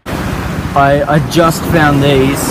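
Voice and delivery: loud distant call; shouting from afar. Real, not acted. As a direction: yell it across the beach. Shouting from far off.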